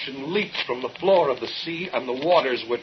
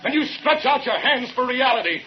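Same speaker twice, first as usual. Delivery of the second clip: climbing higher and louder toward a final cry; building accusation; 7 semitones higher, 4 dB louder than usual. Acted. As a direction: drive each phrase up until the blackout.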